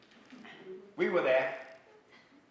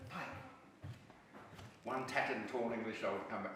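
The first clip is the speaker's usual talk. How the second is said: ordinary talk, nothing marked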